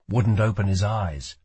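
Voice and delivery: usual speaking voice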